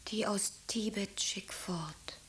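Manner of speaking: soft, slow whisper from a sickbed; dying daughter whispering her one wish; faint whisper. Acted. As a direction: barely breathe the words out.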